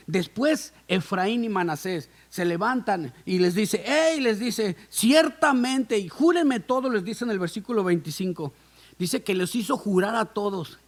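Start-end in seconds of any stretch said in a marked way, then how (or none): 3.9-6.9 higher and louder, slow and emphatic; urgent command. Acted. José making his sons swear, mild urgent call. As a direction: urge the oath on them.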